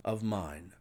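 plain reading voice

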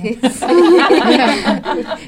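loud laugh. Real, not acted. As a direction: burst of loud, high laughter. Host laughs at guest's joke. (carcajada fuerte y aguda)

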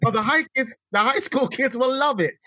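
high-pitched mock London accent; terrible British accent. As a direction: play a Londoner badly in a high voice. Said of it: "You need to keep doing that accent".

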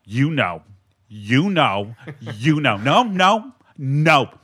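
low, clipped-off angry husband imitation; spaced-out scolding with pauses; Rob. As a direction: imitate a stern husband, phrase by phrase. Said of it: "Rob does this when he's like".

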